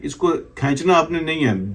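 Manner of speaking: ordinary delivery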